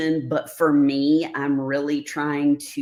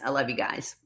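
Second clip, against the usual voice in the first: ordinary talk, nothing marked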